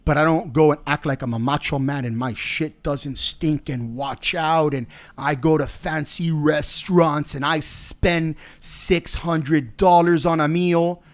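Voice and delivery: ordinary talk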